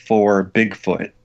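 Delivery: ordinary delivery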